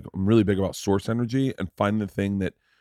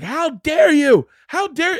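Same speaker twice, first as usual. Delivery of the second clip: much higher, louder shocked lady voice; outraged dog owner; 5 dB louder, slower than usual. Retold or imitated. Dad imitates the lady whose dog he tapped, outraged shriek. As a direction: play the appalled dog lady. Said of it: "she was like".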